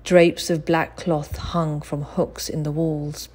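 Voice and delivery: ordinary delivery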